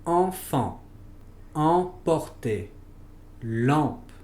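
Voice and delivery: "enfant, emporter, lampe." with the nasal French an. Shown as a demonstration. Teacher modeling nasal an words for Spanish speakers, careful French. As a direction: model the nasal vowel, no n sounded. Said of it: "Por ejemplo".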